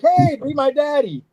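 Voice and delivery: high-pitched, drawn-out chick voice; cutesy begging baby bird; happy baby chick. Acted. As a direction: pipe up like an eager little hatchling.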